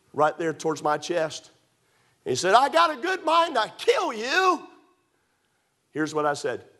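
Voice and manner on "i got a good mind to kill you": slow, high, loud menacing shout; imitating a drunk man's death threat; drunken threat shout. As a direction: threaten him down the barrel. Drunk father threatens with gun.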